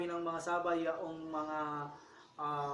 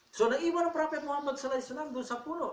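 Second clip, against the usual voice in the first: excited; 8 semitones higher, 5 dB louder, faster than usual. A narrator's reading. Dramatic turn in the story.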